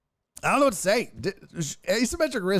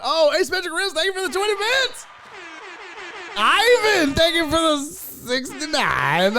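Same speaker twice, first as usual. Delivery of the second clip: hollered thanks; 8 semitones higher than usual. Pretend. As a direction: belt out thanks to each donor, pitched up.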